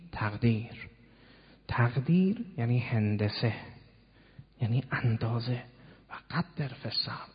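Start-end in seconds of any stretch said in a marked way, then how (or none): 6.1-7.3 «و قدر فی السرد» whispered, breath only; hushed verse. Acted. Preacher to congregation, whispering the verse.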